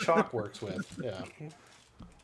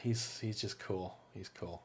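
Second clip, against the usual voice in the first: much softer, lower gravelly rasp; raspy whisper; 10 semitones lower, 12 dB quieter, slower than usual. Retold or imitated. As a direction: rasp it out like a lifelong smoker. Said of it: "Adam, that was perfect".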